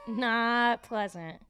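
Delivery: NOT drawn out slowly before pleasant; emphatic agreement. Real, not acted. Agreeing on the disposition of a goose, NOT slowly drawn out. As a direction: stretch NOT long for comic emphasis. Repeats it back for comic emphasis.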